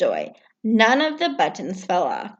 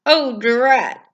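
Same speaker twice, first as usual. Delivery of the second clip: Toad, higher and a bit louder, fed up; mildly grumpy Toad; Toad grumbling; 4 semitones higher, 5 dB louder than usual. Acted. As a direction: grumble like a sore-footed toad. Toad's voice, annoyed.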